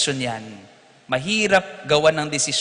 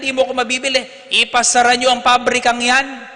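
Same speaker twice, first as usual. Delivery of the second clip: high, loud outraged priest; indignant refusal; 8 semitones higher, 6 dB louder than usual. Retold or imitated. Priest refusing the owner's bribe, outraged and loud. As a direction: throw the offer back at him.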